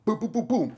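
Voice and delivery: high, loud popping gunshot noises; mock gunfire. Streamer pretends to shoot someone, mock gunfire. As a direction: fire off playful booms.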